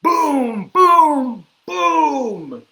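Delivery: very loud high booms, each falling; thunder booms. Acted. Reader makes thunder for kids, booming very loudly. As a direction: belt out three big thunderclaps. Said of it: "Mister Brown makes thunder".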